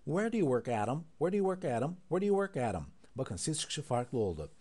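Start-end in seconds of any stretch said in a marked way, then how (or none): 0.1-2.9 quick, higher question; fast. Shown as a demonstration. Teacher speeding up the question for students. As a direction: fire it off at natural speed. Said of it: "Hızlandıralım şimdi".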